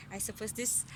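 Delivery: plain reading voice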